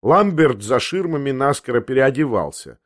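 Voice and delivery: ordinary delivery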